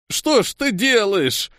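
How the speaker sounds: high, slow, plaintive wail; distressed, whining reproach; tearful. A narrator's reading. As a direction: plead as if close to crying. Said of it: "плачуще говорил Андрюха".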